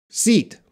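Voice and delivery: seat said high and loud with a long clear ee; teacher says the test word seat to learners; test word said clearly. Shown as a demonstration. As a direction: pronounce seat carefully for the listening test. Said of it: "listen up".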